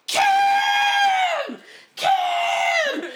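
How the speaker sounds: very high, loud screamed name; screaming a name. Retold or imitated. Fans screaming for Kim, high and loud. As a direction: shriek it across the street. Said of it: "I just hear screaming".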